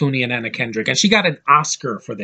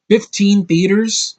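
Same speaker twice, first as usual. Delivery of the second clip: slow, higher disbelieving question; incredulous question; 6 semitones higher, 4 dB louder, slower than usual. Real, not acted. Reviewer balking at a film's tiny release, incredulous. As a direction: draw it out, rising in disbelief.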